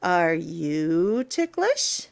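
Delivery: plain reading voice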